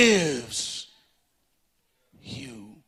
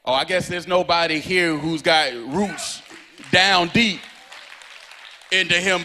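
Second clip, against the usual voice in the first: ordinary talk, nothing marked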